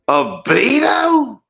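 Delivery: high, drawn-out indignant squeal in a fake accent; mock outraged disbelief. Guest objects to being called a Beatle, mock outrage. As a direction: slowly screech it in mock disbelief.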